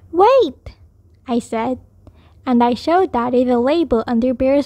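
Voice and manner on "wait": high, sudden call to hold on; urgent interjection. A narrator's reading. Child stopping Daddy from washing Bear, urgent interjection. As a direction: cut in with a higher, urgent voice.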